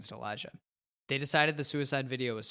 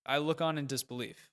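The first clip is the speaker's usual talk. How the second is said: ordinary delivery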